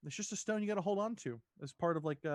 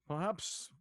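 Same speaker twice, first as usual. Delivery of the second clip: lightly put-on adventurer's companion; a hint of an in-game character; NPC voice; 3 semitones higher than usual. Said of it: "I'm trying to do an N P C voice here".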